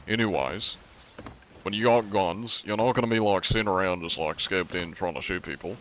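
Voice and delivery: ordinary talk, nothing marked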